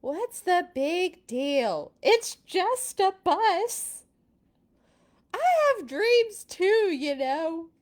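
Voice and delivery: high, loud slow protest; pigeon arguing. Acted. Pigeon protesting to the reader, loud and high. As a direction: whine and argue hard.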